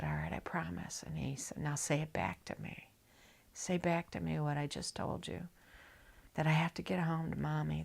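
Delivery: hushed unvoiced whisper; retold bedside whisper. Whispered bedside exchange with dad.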